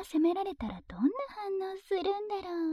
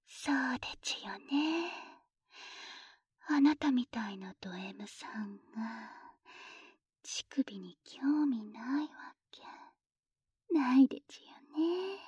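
put-on baby talk; 2 semitones lower, 3 dB quieter than usual. Mocking baby talk to partner.

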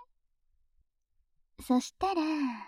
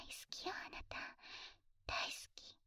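whispering; 17 dB quieter than usual. Acted. Tender confession to a partner.

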